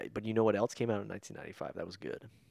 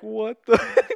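shocked; 11 semitones higher, 11 dB louder than usual. Retold or imitated. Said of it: "they were like".

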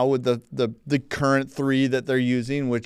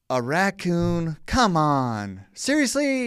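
drawn out, rising to high-pitched disbelief; acted skeptical disbelief; mock incredulous; 4 semitones higher, much slower than usual. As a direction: scoff slowly, end on a high question. Acted disbelief at film premise.

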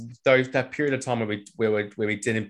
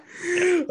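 high laugh. Real, not acted. Reacting to Ronaldo interview.

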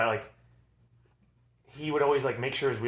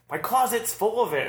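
cartoon Doug; 10 semitones higher, 4 dB louder than usual. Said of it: "Doug's like".

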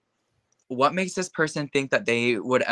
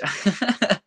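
high burst of laughter; 11 semitones higher, 3 dB louder than usual. Real, not acted. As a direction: laugh out loud at your own demonstration. Laughs at own demonstration.

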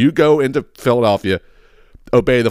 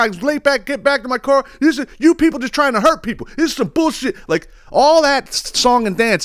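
mocking whiny defendant. Mocking defendants arguing with the judge in a high, whiny voice. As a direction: parody the complainers.

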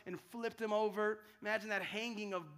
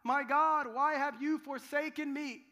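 loud, higher anguished cry; 6 semitones higher, 8 dB louder than usual. Retold or imitated. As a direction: cry out to God in abandonment. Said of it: "Jesus cried out".

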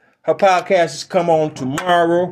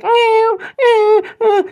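high, loud crying meows; dog whining meows at auntie; mock meowing; 4 dB louder, much slower than usual. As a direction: meow like a sad cat. Dog voiced as cat.

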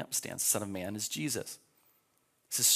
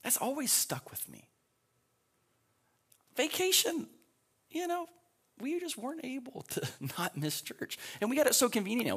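ordinary delivery

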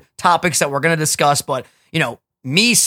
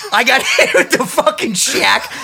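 higher, slower, laugh breaking through; 8 semitones higher, 3 dB louder than usual. Real, not acted. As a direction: lean into it, cracking up. Builds up the punchline twice.

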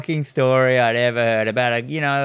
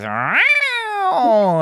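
high squeaky imitated meow; cat meow; 13 semitones higher than usual. Imitating the cat on the man's lap with a high meow. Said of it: "just goes".

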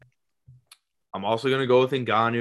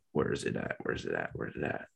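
muttering to himself; 12 semitones lower, 12 dB quieter, faster than usual. Real, not acted. Muttering to himself while searching.

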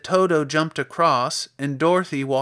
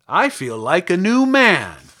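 loud, slow, higher scarecrow voice; relieved scarecrow; 4 semitones higher, 8 dB louder than usual. Acted. Scarecrow just set down on the ground, relieved. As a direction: stretch it out with relish.

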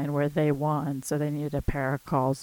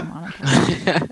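Minotaur said the British way, louder; British accent; 2 semitones higher, 9 dB louder, slower than usual. Retold or imitated. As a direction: imitate a UK speaker saying Minotaur. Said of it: "in the U K, people say".

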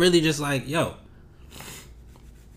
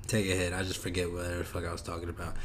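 ordinary talk

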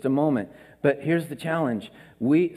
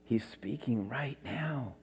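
quiet; 4 semitones lower, 12 dB quieter, slower than usual. Shown as a demonstration. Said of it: "It's in the quiet moments".

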